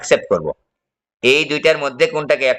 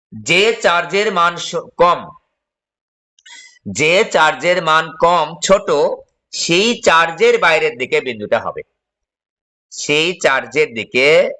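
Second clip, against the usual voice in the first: slow dictation; 3 semitones higher, much slower than usual. Shown as a demonstration. Teacher dictates the condition slowly.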